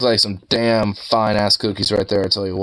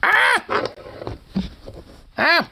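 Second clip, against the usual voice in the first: startled yelp. Real, not acted. Startled by the dog.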